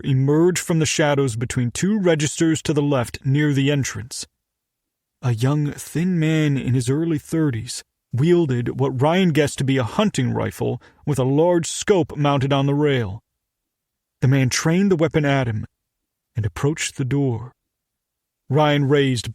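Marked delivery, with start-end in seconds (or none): none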